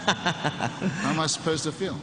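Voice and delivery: high, laughing tease. Real, not acted. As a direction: laugh through the question. Teasing the interviewer about yawning.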